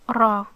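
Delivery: Ra with a Spanish-style R; rolled Spanish R. Shown as a demonstration. Teacher showing learners the Arabic letter, rolled Spanish R in Ra. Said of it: "pronounced like the Spanish".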